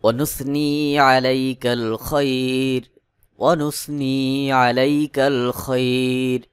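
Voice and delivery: slow, measured Arabic chant with long-held vowels; Quran-style recitation. Shown as a demonstration. Teacher reciting Dua Qunut for learners to memorise, slow chanted recitation. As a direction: recite phrase by phrase, pausing for learners to follow.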